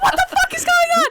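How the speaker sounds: high, fast, loud demand; imitated bewildered shout. Ben demands answers in the canoe, bewildered shout. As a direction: yell it in exasperated alarm.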